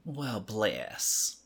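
understated condescending sympathy; veiled Southern insult. Shown as a demonstration. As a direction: say BLESS as a polite put-down. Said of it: "depends on how it's being said".

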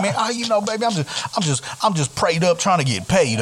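high smooth pimp swagger voice. Retold or imitated. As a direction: slip into a flashy pimp swagger. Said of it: "I wanna get in, like, a [name] voice".

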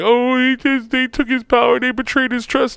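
high-pitched mock whining; exaggerated, high mocking lament; mocking complainers. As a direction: mimic the outraged fans in a high voice. Mocking what everybody says.

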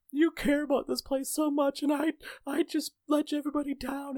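very high, mock-tearful whimper; imitated crying. Crying manager says she let everybody down, imitated whimper. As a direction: confess in an imitated weepy squeak. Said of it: "like, crying and she was like".

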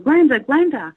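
mother yelling at the cousin in a trance, loud; yelling a name. Retold or imitated. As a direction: yell Glenda to snap her out of it. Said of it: "She was yelling".

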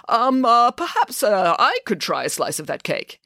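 halting request that jumps up high; hesitant. A narrator's reading. The king shyly asks Scheherazade for cake, hesitant. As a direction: hem and haw toward the request.

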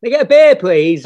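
high, loud acted bar order; calling to the bar. Skint actor ordering at the wrap party, calling out. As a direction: pipe up over a busy bar.

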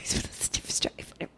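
quick whispered aside, fast. Real, not acted. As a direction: rush it out in a whisper after a laugh. Awkward joke about slavery.

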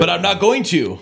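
teasing imitation of a reluctant friend; a hint of mock-stubborn co-host, pitch lifting; mocking co-host impression. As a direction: lightly mimic the co-host, lilting up at the end. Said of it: "Now who am I being?".

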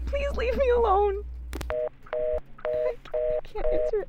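high, shaky panicked pleading. Acted. Operator alone at the board, panicked breaking pleas. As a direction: fall apart in panic, voice high.

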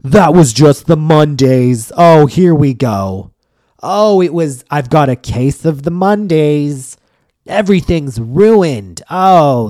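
slow mock moaning about the Mondays; whiny complainer. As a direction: ham up a self-pitying victim, drawn out. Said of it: "they'd go".

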